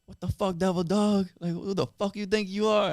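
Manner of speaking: high-pitched retold sergeant chewing-out. Sergeant tearing into a junior Marine at the gate, mock chewing-out. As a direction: imitate an indignant sergeant dressing someone down. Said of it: "he like, chews me out".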